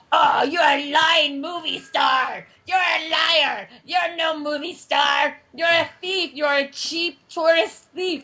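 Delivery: slow, drawn-out mock screaming; screaming cab driver. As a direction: hurl each accusation as a long mock shout. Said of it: "he starts screaming".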